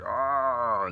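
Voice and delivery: loud open-mouthed mock roar; playful shark roar. Artist voicing the angry shark he is drawing, playful roar. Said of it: "he's gonna be like".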